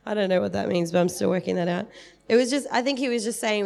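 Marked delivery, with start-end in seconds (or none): none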